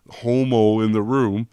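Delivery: sassy comeback, drawn out and loud; emphatic punchline. Retold or imitated. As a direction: land the last words hard.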